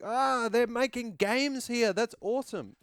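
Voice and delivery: high, loud fanboy gush; reenacted burst of delighted excitement; imitated excitement. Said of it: "I would get so excited".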